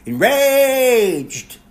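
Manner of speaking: high, loud, hammed-up outburst; mock enraged shout. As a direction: burst out in fake rage. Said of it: "I was enraged".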